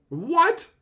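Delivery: high, loud incredulous what; shocked what. Real, not acted. Streamer reading a search result, loud shocked disbelief. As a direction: yelp it in sheer disbelief.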